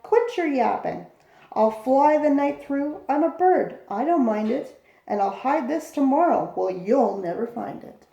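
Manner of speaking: usual speaking voice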